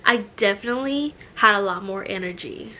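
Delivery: ordinary talk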